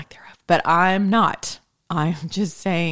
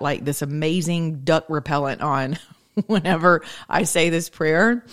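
normal talking voice

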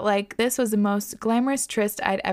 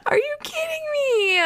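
shrieking disbelief. Real, not acted. Host reacting to the fuckboy's line, shrieking in disbelief. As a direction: scream it in stunned outrage.

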